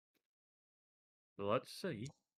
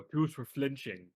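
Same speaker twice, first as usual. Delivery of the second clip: taunting; 5 semitones higher, 6 dB louder than usual. Acted. Player taunts the cloak in character.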